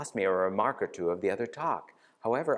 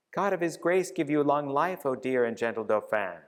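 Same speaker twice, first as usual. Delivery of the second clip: usual speaking voice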